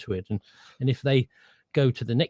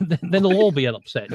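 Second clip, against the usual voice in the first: laughing; 5 semitones higher, 6 dB louder than usual. Real, not acted.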